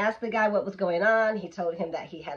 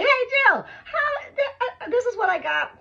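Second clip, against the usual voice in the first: much higher, laughing through the words; laughing call; 4 dB louder than usual. Retold or imitated. Calling her uncle in Kansas at dawn, laughing. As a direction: giggle it out, squeaky and loud.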